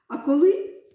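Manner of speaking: usual speaking voice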